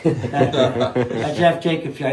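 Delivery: slow, laughing mock grumble on that Jeff Jacobs guy; mock grudge. Real, not acted. Rider teasing old rival for the hosts, slow mock grudge. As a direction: drag out the rival's name, playfully sour.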